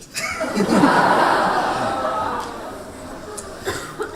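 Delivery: breathy high giggle at his own slip; embarrassed laugh. Real, not acted.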